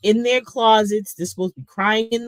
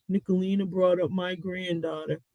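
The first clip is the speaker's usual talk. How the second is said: mock whining; 2 semitones lower, 9 dB quieter than usual. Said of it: "you wanna cry and complain".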